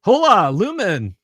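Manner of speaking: bartender calls out across the room; yelled greeting. Acted. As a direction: shout a cheerful hello. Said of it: "the bartender yells out a greeting".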